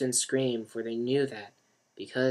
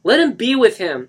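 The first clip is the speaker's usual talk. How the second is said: higher, loud; insistent call; 11 semitones higher, 14 dB louder than usual. Acted. Mark urging Lisa to leave, calling. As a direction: urge her away.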